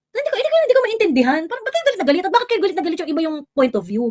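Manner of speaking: high, fast disbelief at the critics; incredulous. Real, not acted. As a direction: rattle it off, baffled and fed up. Baffled at angry critics. (hindi makapaniwala, mabilis at mataas)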